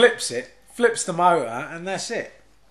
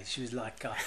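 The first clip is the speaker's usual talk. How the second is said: ordinary talk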